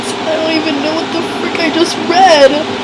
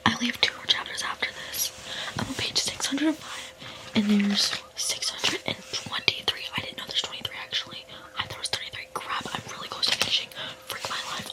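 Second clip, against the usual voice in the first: whispered late at night, scared; hushed, anxious late-night whisper; 17 dB quieter than usual. Real, not acted. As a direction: whisper so nobody wakes up.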